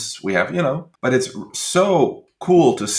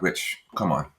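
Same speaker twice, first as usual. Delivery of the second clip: calming boss; 4 semitones lower, 10 dB quieter, much faster than usual. Retold or imitated. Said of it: "my boss goes like".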